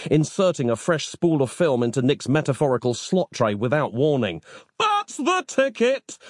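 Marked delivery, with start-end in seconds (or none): 4.8-6.2 high-pitched, drawn-out howl. A narrator's reading. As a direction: howl it in ecstasy, very slowly. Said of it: "Nick howled".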